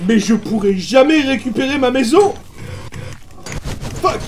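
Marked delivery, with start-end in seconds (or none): none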